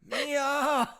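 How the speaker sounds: high, loud call; mock desperate cry. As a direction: call out as if she were slipping away. Calling the lost friend back.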